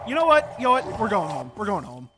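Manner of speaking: loud, clipped frustration; fed-up outburst. Real, not acted. Player quitting the dungeon in disgust, loud and fed up. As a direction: throw up your hands and snap it. Gives up and goes home.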